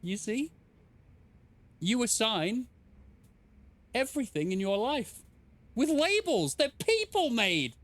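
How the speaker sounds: lightly hammed-up wise guru; mock guru voice. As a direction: preach it like a hammy mystic. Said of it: "All right, I'm stopping".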